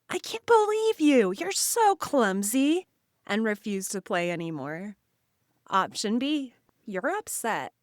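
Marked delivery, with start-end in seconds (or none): 0.1-2.9 high, louder mock outburst; acted yelling. Kid scolding friend over broken toy, high acted yell. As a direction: play a hammed-up angry kid's yell. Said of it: "yell at them".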